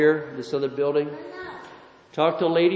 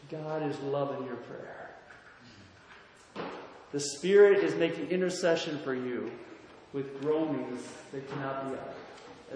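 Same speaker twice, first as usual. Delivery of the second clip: much softer, soothing reassurance; gentle comfort; 3 semitones lower, 9 dB quieter than usual. Retold or imitated. Pastor to weeping caller on the phone, soft and soothing. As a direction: hush it down to comfort her.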